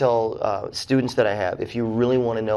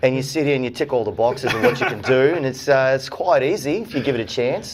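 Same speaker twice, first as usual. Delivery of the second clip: broad Australian accent; 3 semitones higher, 4 dB louder than usual. Shown as a demonstration. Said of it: "Hit, hit us with one".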